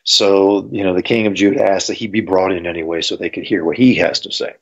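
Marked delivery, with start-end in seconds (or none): none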